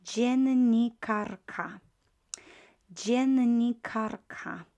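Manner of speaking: dziennikarka said very slowly and loud; slow model word. Shown as a demonstration. Teacher modelling dziennikarka for learners. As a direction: drawl out dziennikarka syllable by syllable. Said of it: "Once again".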